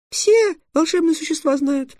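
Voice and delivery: very high squeaky fury; raging fairy. A narrator's reading. Fairy raging at the dragon, shrill and indignant. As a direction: pipe it out in a tiny angry voice. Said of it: "продолжала бушевать фея".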